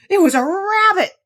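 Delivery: high, loud dog's excited yelp; excited cry. Acted. Fido tells the dolls what he chased, loud and excited. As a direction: blurt it out, all worked up. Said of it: "Fido cried".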